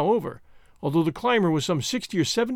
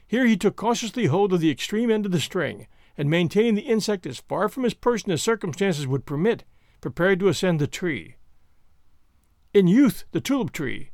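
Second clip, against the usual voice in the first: usual speaking voice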